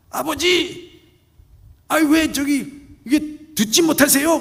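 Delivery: loud, high, exasperated complaint; irritated son. Acted. Son complains loudly to his father about his hearing. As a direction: nag him loudly.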